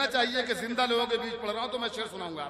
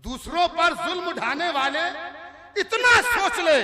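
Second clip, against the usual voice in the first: high, loud declamation; forceful verse recital; 9 semitones higher, 10 dB louder than usual. Acted. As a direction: project the couplet over the crowd. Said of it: "किसी शेर पर जोर लगाऊंगा". (ज़ोर लगाकर शेर पढ़ना)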